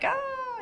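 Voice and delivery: high, drawn-out "aah"; long mock squeal held up high; exuberant cry. Imitating outgoing Calabrians, exuberant high cry. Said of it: "Calabrians are like".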